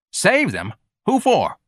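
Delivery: put-on high, challenging boy's voice; brash street kid. A narrator's reading. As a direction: question him like a scornful young tough. Voicing George, challenging.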